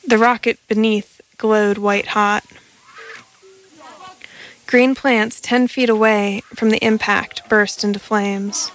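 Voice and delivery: plain reading voice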